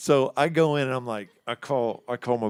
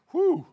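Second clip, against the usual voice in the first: relieved whew; 4 dB louder than usual. Retold or imitated. Relief at bottom of stairs.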